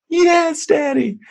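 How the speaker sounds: put-on high, sweet child reply; little girl voice. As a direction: answer as the small daughter, way up high. Daughter's reply voiced by dad.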